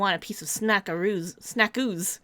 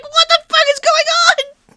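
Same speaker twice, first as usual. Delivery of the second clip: much higher, louder panicked shout; shocked yell; 10 dB louder than usual. Retold or imitated. Recalling gunshots in the old game, yelling. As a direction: blurt it out in total alarm. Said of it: "I was like".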